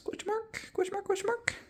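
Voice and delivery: silly high-pitched squeak, repeated; squeaky high voice. Acted. Playful repeated question.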